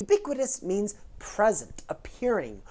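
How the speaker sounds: ordinary talk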